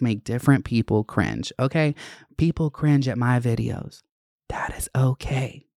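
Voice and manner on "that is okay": slow, deliberate whisper; hushed, measured whisper; whispered reassurance. Real, not acted. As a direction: whisper it slowly for emphasis. Reassuring viewers about being cringe.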